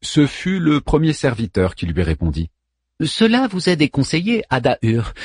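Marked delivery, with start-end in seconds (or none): 3.0-5.1 polite servant robot. Acted. Servant machine voice.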